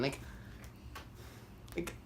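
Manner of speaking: ordinary delivery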